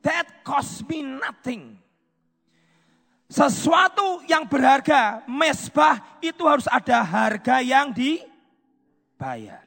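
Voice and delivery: loud, rising preacher's call; preaching. Acted. Preacher pressing the price of the altar, loud and fervent. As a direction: drive the point home to the crowd.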